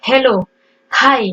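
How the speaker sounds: HELLO and HI with a breathy, sounded H; aspirated H. Shown as a demonstration. Teacher showing learners how to breathe the English H, aspirated H. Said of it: "il faut juste prendre sa respiration".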